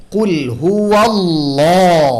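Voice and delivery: হুয়াল্লাহ with a heavy, full lam; slow recited কুল হুয়াল্লাহ, Allah made heavy. Shown as a demonstration. Tajweed teacher showing tafkhim: slow recitation with a heavy Allah. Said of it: "ভারী করব কিভাবে".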